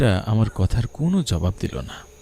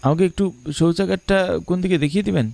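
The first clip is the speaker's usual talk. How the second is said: nervous request; 9 semitones higher, faster than usual. Acted. Frightened boy asks the stranger.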